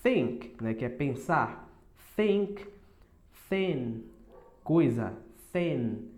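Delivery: careful English th; English th demo. Shown as a demonstration. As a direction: think and thin with the tongue between the teeth. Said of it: "assim você pronuncia o som do T H".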